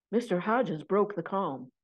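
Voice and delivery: plain reading voice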